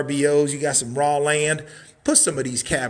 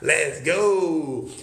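ordinary talk, nothing marked

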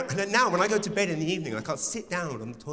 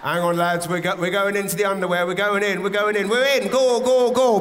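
louder and higher, rallying troops; charging battle cry; 5 semitones higher, 8 dB louder than usual. Acted. Wee droplets storming the underwear, rising battle cry. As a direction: lead the charge, getting louder.

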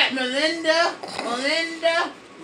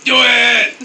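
louder, egging on; shouted urging; 4 semitones lower, 6 dB louder than usual. Real, not acted. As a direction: yell it like a dare. Urging the character.

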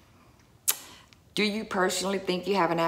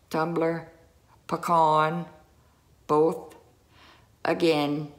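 normal talking voice

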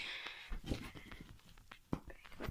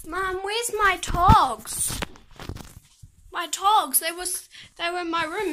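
yelling across the room; 3 semitones higher, 7 dB louder than usual. Real, not acted. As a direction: call out so she hears you. Calling to Mum elsewhere.

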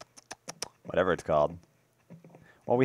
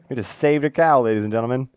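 ordinary talk, nothing marked

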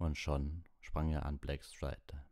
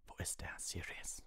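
hushed, tense near-whisper; taut with tension; 2 semitones higher, 7 dB quieter than usual. A narrator's reading. Lupin asking Sirius where he is, tense and hushed. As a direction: ask it under your breath, strained. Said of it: "seine Stimme war zum Zerreißen gespannt".

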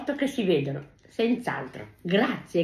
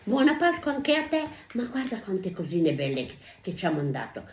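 ordinary talk

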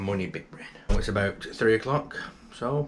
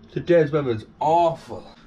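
ordinary talk

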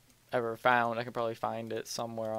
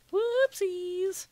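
squeaky playful high voice; silly high whoopsies; 6 dB louder than usual. Real, not acted. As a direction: chirp it up high and cute. Forgot the sand.

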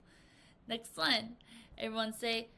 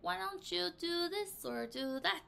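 higher, slow musing suggestion; suggesting; 9 semitones higher, slower than usual. Shown as a demonstration. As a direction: offer an idea gently, thinking aloud. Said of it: "So you're saying".

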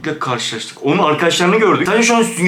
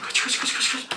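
whispered, much softer, urgent; breathless whisper; 11 dB quieter than usual. Real, not acted. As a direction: hiss the word over and over while running. Fleeing, urging himself. (fısıltıyla telaşlı kaç)

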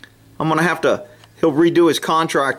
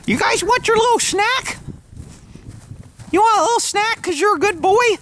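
put-on pet baby talk. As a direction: coo at the horse like a baby.

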